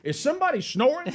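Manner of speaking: high-pitched mock indignation; incredulous question. Man pitching his drug hears snoring, incredulous question. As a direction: pipe it up, appalled at the sound. Acting out annoyed conference caller.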